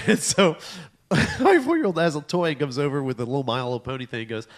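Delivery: ordinary talk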